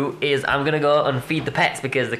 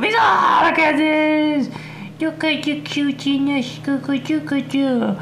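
high, squealing puppy-adoring coo. Real, not acted. Vlogger finds cute beagle puppies, gushing in baby talk. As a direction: melt over cuteness in a high squeal.

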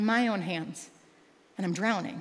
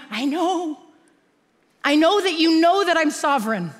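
voicing God gently; 7 semitones higher, 9 dB louder than usual. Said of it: "he's going".

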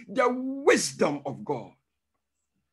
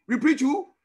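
rousing call again; 6 semitones higher, 4 dB louder than usual. Real, not acted. Call to congregation repeated.

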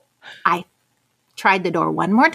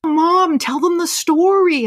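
high, louder, urging call; imitated eager daughter; 7 semitones higher, 4 dB louder, slower than usual. Daughter asks mom to tell the story, high urging voice.

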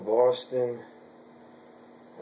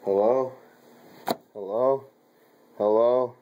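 repeated loud questioning hellos, growing louder; mic check calls; 4 dB louder than usual. Real, not acted. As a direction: keep calling to see if the mic works.

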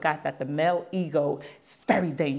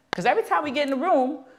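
high, insistent complaint, rising as it goes; exasperated scolding; 8 semitones higher than usual. Retold or imitated. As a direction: pile up the accusations, fed up. Scolding him for always arguing.

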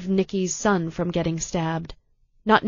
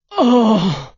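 sharp loud pained cry; stabbed and crying out; 3 semitones higher, 8 dB louder than usual. A narrator's reading. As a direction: cry out as the knife goes in.

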